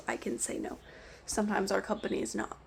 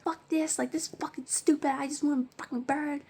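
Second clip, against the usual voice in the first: retold angry cursing; 5 semitones higher than usual. Angry customer leaving the store without the bird, mock cursing. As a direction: imitate someone cursing on the way out. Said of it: "heard them cursing".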